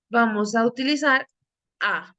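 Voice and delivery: ordinary talk, nothing marked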